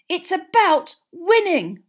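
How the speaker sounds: slow, high, forceful shout. A narrator's reading. Boy insisting the tournament is for winning, shouting. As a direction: shout each word hard. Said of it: "shouted Ron".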